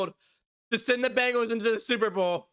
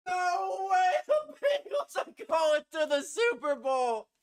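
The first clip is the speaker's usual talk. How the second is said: high disbelieving yell, drawn out slow; ecstatic shout; 11 semitones higher, slower than usual. Real, not acted. Fan yelling as team reaches Super Bowl, high slow shout. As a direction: shout in pure joy and disbelief. Fan watching winning kick.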